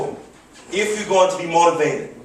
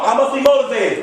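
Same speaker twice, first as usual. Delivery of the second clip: raised, rallying pitch; with more passion; 6 semitones higher than usual. Shown as a demonstration. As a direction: lead the chant with excitement. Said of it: "I don't feel you yet".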